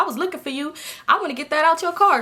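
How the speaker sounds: lightly voiced excited stranger; imitating the man. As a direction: play him chasing her down. Voicing the crackhead man.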